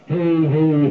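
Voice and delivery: loud mic test through fuzz; fuzzed-out distorted voice; fuzz distortion. Shown as a demonstration. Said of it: "you can hear that fuzz happening".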